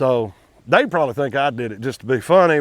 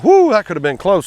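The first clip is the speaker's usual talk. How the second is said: higher, louder whoo of relief; relieved exclamation; 5 semitones higher, 6 dB louder than usual. Retold or imitated. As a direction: blurt it out like a near miss. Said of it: "And I was like".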